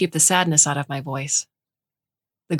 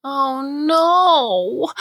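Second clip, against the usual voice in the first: high, loud dismayed wail; 10 semitones higher, 4 dB louder than usual. A narrator's reading. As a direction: cry out in grief. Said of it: "Karina wailed".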